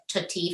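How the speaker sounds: cookie said as "tutti"; child's T-for-K error in cookie. Shown as a demonstration. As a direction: swap both K sounds in cookie for T. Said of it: "substitute one sound for another, like T for K".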